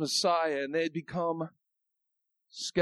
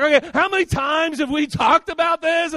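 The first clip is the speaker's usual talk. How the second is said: exasperated parent; 8 semitones higher, 5 dB louder than usual. Retold or imitated. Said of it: "I'm like".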